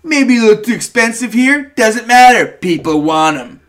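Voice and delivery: lightly voiced pushy record guy; a hint of a know-it-all vinyl dealer; imitating record guy. Retelling the vinyl guy's pitch.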